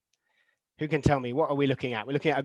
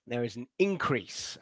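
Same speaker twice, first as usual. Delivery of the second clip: noun "increase" demonstrated softly as IN-crease; stress on first syllable; 3 semitones lower, 5 dB quieter than usual. Shown as a demonstration. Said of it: "it is the first syllable".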